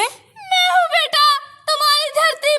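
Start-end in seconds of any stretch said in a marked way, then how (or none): 0.0-1.2 crying. Acted. Mother Earth weeping.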